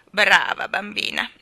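loud, clucking bird praise; approving cluck. A narrator's reading. Starling praises the generous baby, approving cluck. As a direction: cluck your approval loudly. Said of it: "chiocciò lo stornello con approvazione".

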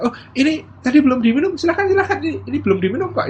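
high-pitched, eager mock hospitality; offering host. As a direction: act a host pressing a drink on a guest. Acted host offering leftover drink. (tuan rumah menawarkan dengan nada tinggi)

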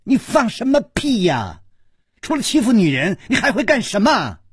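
high-pitched indignant outburst; tearful howling. Acted. Young wife jumping up at her mocker, tearful howl. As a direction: snap back in a crying rage. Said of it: "跳起来哭吼着说".

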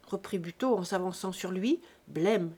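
plain reading voice

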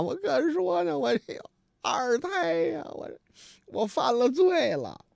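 high, slow, tearful lament; mock crying. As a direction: whine it slowly like a weeping drunk. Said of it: "哭的呀". (学醉汉慢慢哭诉，声音高)

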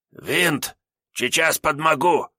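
high, strained hoarse call; hoarse, squeezed voice. A narrator's reading. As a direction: squeeze it out hoarsely, half stuck. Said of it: "хриплым, сдавленным голосом". (хриплым сдавленным голосом)

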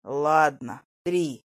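low, reluctant concession; grudging grumble. A narrator's reading. Lucas gives in to the girl, grumbling. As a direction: give in, displeased. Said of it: "недовольно пробурчал Лукас".

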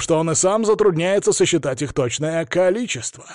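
showman's fight-night build-up; ring announcer. A narrator's reading. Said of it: "Прожекторы снова сфокусировались на ринг-анонсере".

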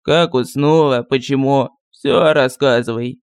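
put-on low, slow, grumbling old sorcerer; lightly voiced village sorcerer; gruff sorcerer voice. A narrator's reading. Sorcerer voice held. (ворчливый голос колдуна)

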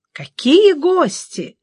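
drawn-out, welcoming exclamation; cordial exclamation. A narrator's reading. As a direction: greet an unexpected guest cordially, stretching the words. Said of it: "радушно воскликнул он".

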